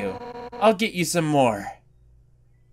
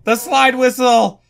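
louder, higher outcry on "slide whistle"; raised, incredulous exclamation; exclaiming; 7 semitones higher, 5 dB louder than usual. Real, not acted. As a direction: call out "slide whistle" in disbelief. Repeats it as an outcry.

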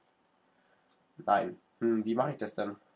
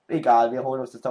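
usual speaking voice

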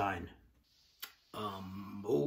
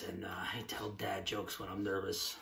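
embarrassed whispered aside; 5 semitones lower, 11 dB quieter than usual. Real, not acted. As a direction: whisper it under the breath.

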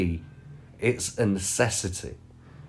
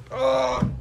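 much higher startled exclaiming; high alarmed oh no; 13 semitones higher, slower than usual. Real, not acted. Streamer in high-pitched alarm on stream. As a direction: gasp in mock panic.

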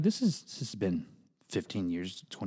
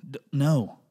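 ordinary talk

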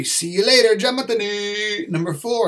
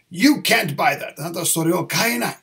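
normal talking voice

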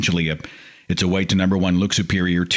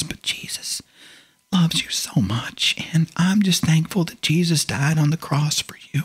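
hushed condescending whisper; mock whisper; 6 semitones higher than usual. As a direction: whisper it like a secret blessing. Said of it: "condescending look and say".

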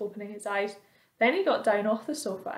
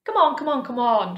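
louder and higher, hurrying; impatient shout; 3 semitones higher, 7 dB louder than usual. A narrator's reading. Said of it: "shouted the centipede".

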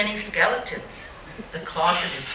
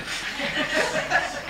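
breathy mocking giggle, higher; 8 semitones higher, 3 dB quieter than usual. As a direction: giggle after the catcall. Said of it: "And then they would laugh".

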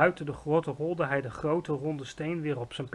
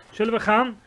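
bright-eyed, high, loud question; too loud; 7 semitones higher, 8 dB louder than usual. A narrator's reading. Sophie asking to leave, too loud for giant. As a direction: ask it at full volume, pitched up. Girl talks too loudly in ear.

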